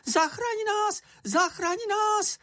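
very high squeaky plea; tiny creature's falsetto cry; shrieking for help. A narrator's reading. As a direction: squeal it out, drawing out the last word. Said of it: "zakřičel čenich".

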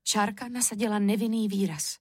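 usual speaking voice

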